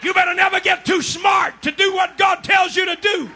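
shouted, hammering preacher cadence; fiery revival preacher shouting; preaching at full force. Acted. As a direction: drive it home to the congregation.

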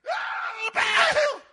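very high, straining cry; hammed-up last desperate howl; mock exhausted wail. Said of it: "they sit down panting".

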